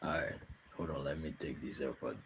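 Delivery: faint, distant voice off the mic; soft and far away, library hush; quiet but clear. Real, not acted. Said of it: "You just sound like you're, like, in a library".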